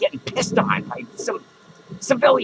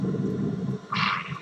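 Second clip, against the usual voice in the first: long voiceless sigh; soft exhaled sigh; 6 dB quieter than usual. Acted. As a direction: let out a long, soft breath. Sighs after boasting.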